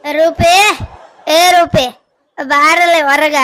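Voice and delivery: loud shout to someone inside; mother-in-law shouting for Roopa to come out; calling out. Acted. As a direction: yell across the house. Calling daughter-in-law outside.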